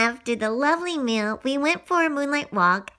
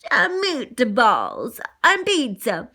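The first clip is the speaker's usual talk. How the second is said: put-on Italian accent; 2 semitones lower, slower than usual. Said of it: "The owner, Tony, said".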